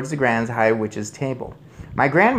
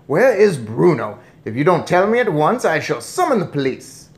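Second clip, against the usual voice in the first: loud, higher threatening demand; angry cry; 5 semitones higher, 6 dB louder than usual. A narrator's reading. Father threatens grandmother with police, angrily. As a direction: threaten her at full bluster. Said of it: "cried Mister Jenkins".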